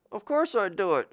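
high and indignant; high voice of Isaac. Acted. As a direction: squeak it out, offended.

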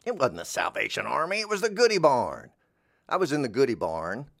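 ordinary delivery

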